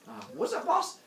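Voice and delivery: high, perky put-on helper voice; eager messenger. Hermes answers Zeus, high eager sidekick.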